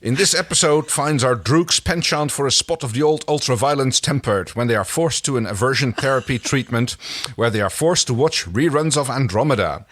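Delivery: mock trailer announcer; teaser announcer read. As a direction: pitch it up like a trailer voiceover. Next-episode teaser read.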